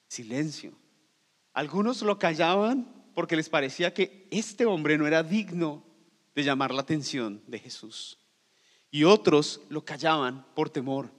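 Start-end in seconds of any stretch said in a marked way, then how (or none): none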